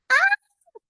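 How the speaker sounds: very high scream of fright; granny scream. A narrator's reading. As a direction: shriek as the startled granny. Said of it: "yelled Granny".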